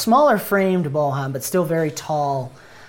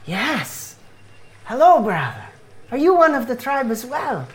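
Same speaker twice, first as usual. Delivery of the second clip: put-on higher-pitched, accented greeting to a stranger; friendly evangelist in a raised put-on voice; curious missionary; 9 semitones higher, 4 dB louder than usual. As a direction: question the newcomer with earnest curiosity. GM plays Bohan missionary.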